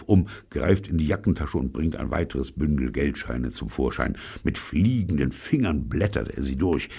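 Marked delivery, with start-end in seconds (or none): none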